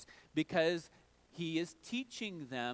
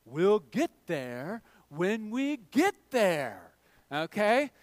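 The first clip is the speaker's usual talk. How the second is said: slow, louder dad-voice impression of Mister Incredible; 7 dB louder, slower than usual. Dad answering kids on the road trip, slow and weary as Mister Incredible. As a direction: drag it out like a fed-up superhero dad. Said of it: "Mister Incredible on the road trip".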